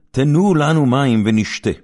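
high, loud plea for water; the people cry to Moses for water, loud and high; demanding cry. Acted. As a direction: call out like a thirsty crowd.

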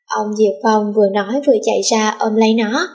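ordinary delivery

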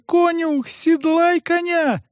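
put-on high, urgent girl's wail; high-pitched, pleading urgency; wailing plea. A narrator's reading. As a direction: wail the order with drawn-out final words. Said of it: "запричитала девочка".

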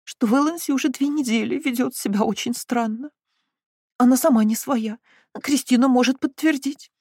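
high, teary lament; tearful. Acted. Mother tearfully telling the family of her daughter's strangeness. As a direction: plead through tears, voice rising. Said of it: "сквозь слёзы сказала она".

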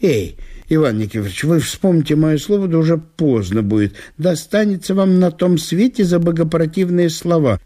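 mild preachy warning; pious reproach. A narrator's reading. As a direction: wag a finger in a half-earnest warning. Ivan Ivanovich admonishes.

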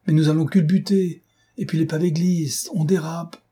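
high, alarmed passenger voice; frightened and high-pitched; panicked warning. A narrator's reading. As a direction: plead in panic as the car skids. Frightened passenger in speeding car.

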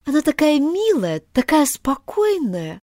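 put-on dreamy, slowing girl's voice; thoughtful. A narrator's reading. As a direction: linger on it, musing. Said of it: "задумчиво продолжала Алиса".

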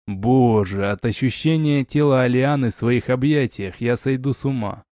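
plain reading voice

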